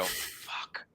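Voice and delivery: voiceless curse under the breath; resigned curse. Retold or imitated. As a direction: let it out very softly, defeated. Retold reaction to being cornered.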